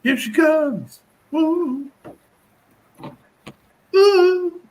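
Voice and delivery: high, whooping cheer; excited cheer. Real, not acted. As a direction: whoop as she walks in. Cheering someone's arrival.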